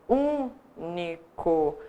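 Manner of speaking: único split slowly into syllables, ú-ni-co. Shown as a demonstration. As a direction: lay out único one syllable at a time. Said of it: "se vocês forem separar a sílaba". (único dito devagar, sílaba por sílaba)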